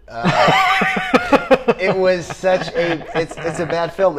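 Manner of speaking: high squeal of laughter, then slow talk through it; laughing burst. Real, not acted. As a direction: crack up and let the laugh carry the words.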